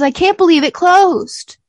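high, loud imitated dismay; mock upset. Imitating Marnie after the portal closes, mock upset. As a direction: wail it, mocking her upset. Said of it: "And then she's upset".

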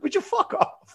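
high, laughing mock protest; laughing retort. Comic shrugging off his friend's late apology, laughing. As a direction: laugh it out in disbelief.

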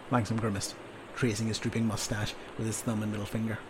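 ordinary delivery

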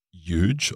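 "huge" said as yooj, no h; "huge" beginning straight on a y sound; silent H in huge. Shown as a demonstration. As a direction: leave the h off the start of "huge". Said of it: "with a silent H in there".

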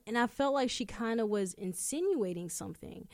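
ordinary talk, nothing marked

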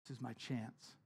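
soft, low, hopeful murmur; a quiet, private plea; hushed hope. Retold or imitated. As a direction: say it quietly to yourself, clinging to hope. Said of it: "this girl is like".

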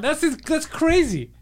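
high, slow drawn-out disbelief; exasperated, pitched well up; incredulous. Real, not acted. Exasperated argument over a false belief.

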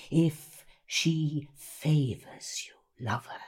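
slow, hushed whisper. Acted. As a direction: draw it out in a low intense whisper. Whispered dramatic reading.

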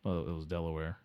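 muttering dim guest; Delaware Dave. Acted. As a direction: drift in like a sleepy guest. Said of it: "Delaware Dave voice".

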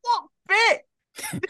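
very high, scoffing question; high-pitched mock bafflement; incredulous. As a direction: squeak it in disbelief. Player mocks the clown's act.

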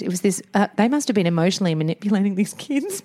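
ordinary talk, nothing marked

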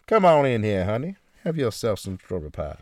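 folksy American southern hostess voice; Southern US accent, inviting a guest to pie. Pretend. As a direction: welcome them in with Southern charm. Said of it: "Is that the southern thing".

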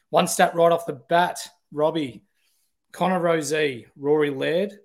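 ordinary delivery

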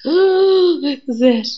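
high, breathless "oh" of sudden insight; a gasped-out epiphany; stunned realization. Retold or imitated. Inner realization quoted.

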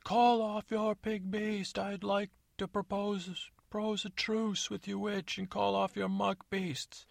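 high, slow pompous call from the walls; high slow theatrical yell; prince yelling down. Acted. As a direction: proclaim it like a hammy prince. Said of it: "he said".

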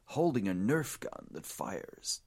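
slow, drawn-out fight announcer call; ring announcer. Acted. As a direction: introduce the blue corner like a boxing MC.

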